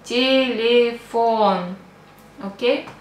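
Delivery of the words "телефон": telephone in slow Russian syllables, ti-li-FON. Shown as a demonstration. Teacher showing the vowel rule, slow syllables with E sounds. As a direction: stretch telephone out, both early vowels as "ee". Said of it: "ye we pronounce like E".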